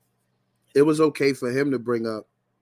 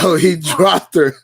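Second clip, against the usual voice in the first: higher, louder, slow hype call; excited yo; 6 semitones higher, 9 dB louder than usual. Real, not acted. Reactor hyped as the host shuts her down, loud call.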